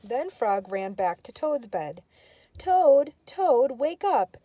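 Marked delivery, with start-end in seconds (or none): 2.6-4.4 loud high wake-up call. Acted. Frog calling Toad awake, loud and high. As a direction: rouse a sleeping friend loudly.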